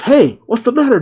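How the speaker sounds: high-pitched "hey", kept light; mock yell. Doctor Hedo calls to his frozen robot, mock yell. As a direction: act out a yell without much volume. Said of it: "and Hedo yells".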